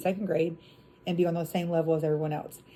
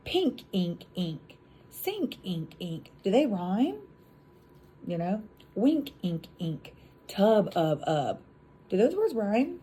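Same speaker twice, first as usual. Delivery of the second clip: rhyme drill; slower than usual. Shown as a demonstration. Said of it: "Do these words rhyme?".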